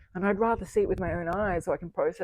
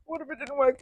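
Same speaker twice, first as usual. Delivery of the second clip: the fearful side of the roller coaster; worried take; 8 semitones higher than usual. Retold or imitated. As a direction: fret it out. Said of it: "So I was this kind of roller coaster".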